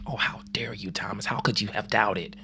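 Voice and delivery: hushed mock rebuke; a soft, mock stern scolding whisper. Jesus not saying this to Thomas, a hushed mock scold. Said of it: "He doesn't pounce on his head".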